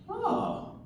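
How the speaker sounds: put-on high, soft old-man voice; a startled old man's quiet squeak; surprised old man. Old technician finding the patient on a chair, surprised and high.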